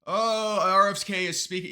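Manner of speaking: high, loud imitation of an oblivious anchor; hammed-up anchor, pitched well up; mock lackadaisical anchor. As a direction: mimic a clueless anchor, loudly and mockingly. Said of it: "these anchors, they're like".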